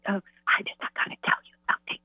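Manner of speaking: soft breathy whisper, voice dropped. Retold or imitated. The father at work whispering his news over the phone. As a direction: whisper like you're hiding at work. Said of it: "And he's, like, whispering".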